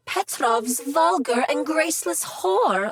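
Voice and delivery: ordinary talk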